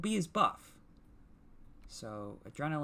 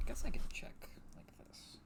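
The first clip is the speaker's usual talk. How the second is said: whispering; 14 semitones higher, 6 dB quieter than usual. Real, not acted. Peeking quietly at the screen.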